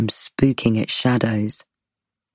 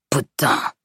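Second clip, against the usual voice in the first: spat out in French. A narrator's reading. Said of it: "he spits out a single word".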